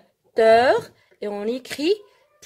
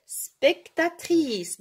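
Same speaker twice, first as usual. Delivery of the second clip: very slow, higher-pitched, each syllable apart; deliberate model word; 7 semitones higher, much slower than usual. Shown as a demonstration. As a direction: sound out the feminine form slowly and high. Teacher contrasts feminine with masculine. (mot modèle très lent, plus aigu, bien découpé)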